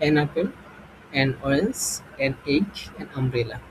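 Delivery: teacher reading underlined examples slowly; slow pointed reading. Shown as a demonstration. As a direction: point at each word as you say it. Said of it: "underline করে দেখিয়ে দিই".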